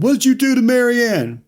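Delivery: high, loud mock demand; Kevin confronting Milton. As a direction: shout the accusation. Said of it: "And he's like".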